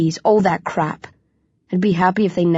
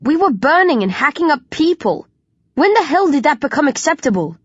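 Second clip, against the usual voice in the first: angry outburst; 7 semitones higher than usual. A narrator's reading. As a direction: rage at the horror of it. Furious outburst about killing.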